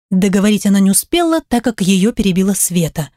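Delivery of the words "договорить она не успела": plain reading voice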